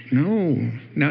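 normal talking voice

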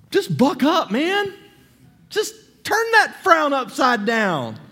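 high, loud, breezy brush-off; dismissive cheer-up. Retold or imitated. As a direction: play the glib friend who shrugs off your pain. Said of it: "somebody come along and be like".

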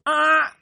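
high yelp of falling; a short falling cry; screaming while falling. Pretend. Falling into the pit.

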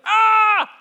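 loud, high scream of frustration; short exasperated shriek, retold from a dream; frustrated scream. Youth leader ignored by students in dream, frustrated scream. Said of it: "I was so frust".

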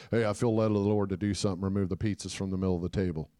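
low, rapid-fire interjection; imitated friend's hurried low announcement; abruptly piping up. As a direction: blurt it out quickly in a low voice. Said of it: "He pipes up".

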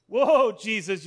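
loud, hammed-up amazement; astounded Nathanael. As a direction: burst out as if mind blown. Nathanael acted as astounded by Jesus.

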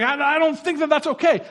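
a mock worried objection; anxious objection. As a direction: squirm out of it nervously. Imagined reluctant reply to Jesus.

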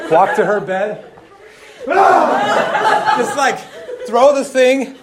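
loud and laughing through the story; can barely get it out for laughing. Real, not acted. Telling the congregation the prank, laughing loudly.

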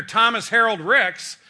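loud, slow, each name hammered out; full-name scolding. Retold or imitated. Angry wife calling husband's full name, loud and slow. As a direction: spell out the full name like he's in big trouble. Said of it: "I've got a serious problem".

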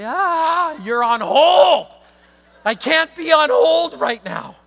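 loud, high, slowed panicked wail; hammed-up on-hold panic; frantic. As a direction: mock-desperate cry at the hold music.

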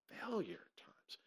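breathy whispered payoff. Shown as a demonstration. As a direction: whisper the last words like a secret. Preacher drops to hushed ending.

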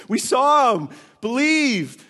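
hammed-up excited disciples; eager urging. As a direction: plead excitedly with Thomas. Disciples urge Thomas.

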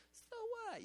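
very high whiny shrugging voice; hammed-up whiny "So what?" squeaked up high; mock dismissive. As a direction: brush it off like a spoiled kid. Dismissive inner voice quoted.

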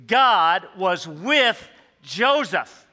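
very slow, every word weighted; emphatic truth. Acted. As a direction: lay down the central truth slowly. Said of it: "underscores one central truth".

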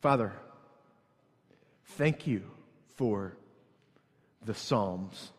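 prayer. Acted. Pastor praying for congregation, soft. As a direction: pray quietly and earnestly.

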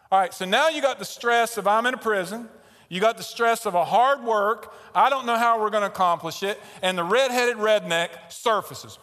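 loud, high, heated storytelling; worked-up retelling. Retold or imitated. Preacher reliving prison ridicule for the congregation, loud and worked up. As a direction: build the stress at full volume.

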